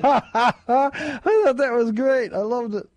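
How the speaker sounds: high, slow laughing delight. Real, not acted. Laughing at the funny text.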